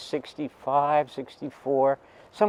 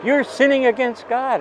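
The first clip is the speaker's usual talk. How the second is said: much higher, louder accusing shriek; retold scream; 12 semitones higher, 5 dB louder than usual. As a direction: shriek it like the furious aunt. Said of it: "she was screaming at me".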